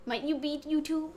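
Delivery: ordinary talk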